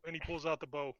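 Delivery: ordinary delivery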